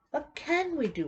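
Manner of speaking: mock exasperated plea. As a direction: throw "What can we do?" up high like a frustrated crowd. Repeats the question, voicing people's frustration.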